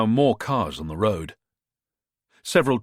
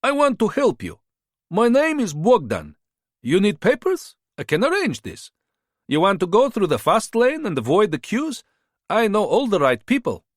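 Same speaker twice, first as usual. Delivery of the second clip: put-on higher-pitched, smooth-talking hustler with a foreign accent; charming border fixer; 11 semitones higher than usual. A narrator's reading. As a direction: sell yourself like a friendly tout. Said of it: "His Russian had an accent".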